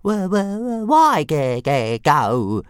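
high, loud stammering creature voice; shuddering little dragon. A narrator's reading. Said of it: "shuddered Toothless".

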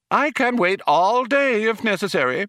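high-pitched, prim insistence; stern matron. Acted. Computer waits for the culprit to confess, stern high matron. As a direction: hold out primly, pitched right up. Eddie's matron voice holds out stubbornly.

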